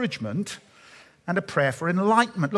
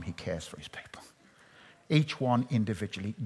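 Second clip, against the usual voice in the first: breathy whisper, barely voiced; 8 semitones lower, 8 dB quieter than usual. Preacher telling the congregation of God's care, in a whisper. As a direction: drop to a hush as if sharing a secret. Intimate dropped aside.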